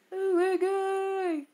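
high, stretched-out disbelieving cry; stunned oh my god. Real, not acted. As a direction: drag it out slow, way up high. Reacts to opponent's team.

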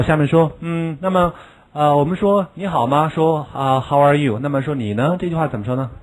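usual speaking voice

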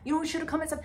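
scolding the clerk for the scare; exasperated rant. Retold or imitated. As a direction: scold Sharon in disbelief. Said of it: "I'm like".

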